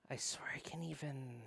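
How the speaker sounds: breathy whispered confession. Acted. Narrator whispering a secret to her partner. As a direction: barely voice it, leaning close.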